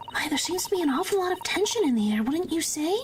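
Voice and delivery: hamming up a sly aristocrat; sly character voice. Acted.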